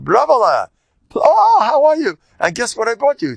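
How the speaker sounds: high, loud, gushing sister's voice; a sister cooing over her brother; sister's excited greeting. Retold or imitated. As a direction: greet your long-lost brother with delight. Sister greets Rava after years.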